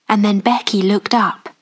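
usual speaking voice

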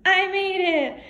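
high, loud happy cheer; excited cheer. Real, not acted. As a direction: celebrate the throw out loud. Beanbag lands in bucket.

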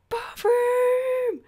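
high, loud showman's cry; grand announcement. Pretend. Vlogger to viewers on her room tour, high loud announcement. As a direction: proclaim it like a game show reveal.

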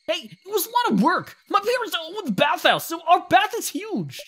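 put-on high, loud indignant boy; protesting kid. Kid defending his bathhouse chores to shopkeeper, indignant. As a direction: squeal in outrage like a little kid.

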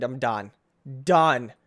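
ordinary talk

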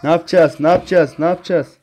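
high, loud panicked yelling; panicked shout. Real, not acted. Gamer panics at a scream, yelling. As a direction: scream in fear, over and over.

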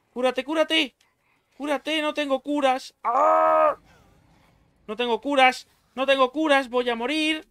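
high, loud frantic pleading; desperate, about to die in game; panicking. Real, not acted. Low health, panicking. (desesperado y agudo)

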